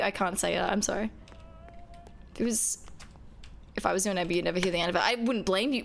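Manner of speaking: normal talking voice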